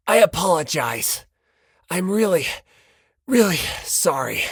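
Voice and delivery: lightly voiced, earnest Komaeda apology; a touch of a sincere, strange apology; unfamiliar earnest voice. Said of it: "spoke in a voice the likes of which Hinata had never heard before".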